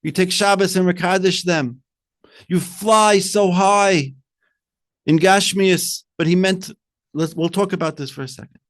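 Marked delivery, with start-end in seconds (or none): 2.5-6.0 long stretched, called-out exaltation; soaring emphasis. Real, not acted. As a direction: draw it out as if soaring. Word-painting the soaring heights.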